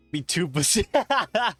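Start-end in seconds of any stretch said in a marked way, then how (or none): none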